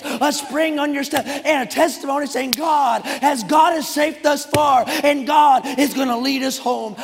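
high, loud revival preaching; shouted, exultant pulpit cadence; full-force preaching. Real, not acted. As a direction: preach it like a rousing revival, driving every phrase.

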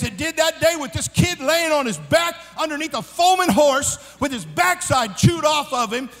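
high, loud preacher drive; preaching at full force. Acted. Preacher warning young people about own plans, full-force preaching. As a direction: hammer the warning home to the youth.

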